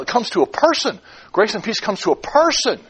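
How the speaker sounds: "person" lifted high and punched; high, emphatic landing on "person"; stress on PERSON. Shown as a demonstration. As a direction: drive each phrase onto "person". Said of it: "By emphasizing different words".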